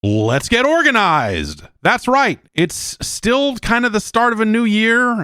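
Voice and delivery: upbeat sponsor read. Acted. Host opening a sponsor read, upbeat. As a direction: sell it brightly.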